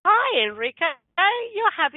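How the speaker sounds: high, loud cheerful hello; delighted greeting. Retold or imitated. Greeting patient skipping down hallway.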